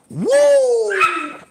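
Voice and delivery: loud high whoop of delight. Real, not acted. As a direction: let out a big celebratory woo. Excited whoop.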